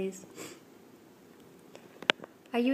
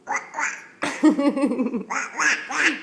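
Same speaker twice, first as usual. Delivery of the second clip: real rippling giggles; delighted giggling burst; bubbling laugh; 3 semitones higher, 4 dB louder than usual. Real, not acted. Laughing before duck noises.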